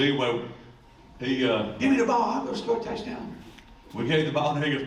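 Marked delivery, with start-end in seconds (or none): 1.8-3.3 high, squeaky imitation of a player demanding; high-pitched player voice. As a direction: mimic a keyed-up teammate way up high. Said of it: "he has a high-pitched voice".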